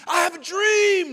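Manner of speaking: loud, high, ringing oratory; impassioned speech-making shout; Martin Luther King Jr. Retold or imitated. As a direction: proclaim it to a huge crowd. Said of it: "Doctor Martin Luther King".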